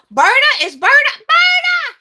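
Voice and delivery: high, loud greeting call; excited shout. Real, not acted. Greeting a viewer she recognizes, excited high shout. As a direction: call out to a friend with delight. Spotting a friend in chat.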